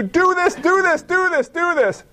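high, insistent repeated command; commanding Jesus. Retold or imitated. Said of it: "Jesus comes in and he says to you".

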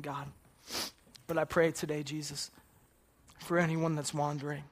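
earnest spoken prayer to God. Acted. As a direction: pray aloud for the wanderers. Leads a prayer.

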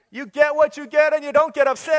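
put-on high, loud sing-song child voice; TV-show catchphrase. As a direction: chant it like a kid's show.

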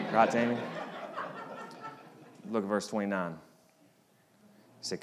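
usual speaking voice

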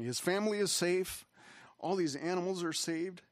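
choked up, pitch lifted; getting emotional. Real, not acted. Preacher moved that Noah's family is safe, getting emotional. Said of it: "I don't know why I'm getting emotional".

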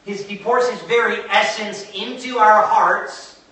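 insistent preacher, driving home each key word; impassioned preaching. Real, not acted. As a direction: preach it with mounting conviction.

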